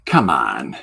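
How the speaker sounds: low, loud scoffing come on; a deep, loud dismissal in a TV debate; dismissive come on. Retold or imitated. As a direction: brush him off with contempt. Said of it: "looks at me and goes".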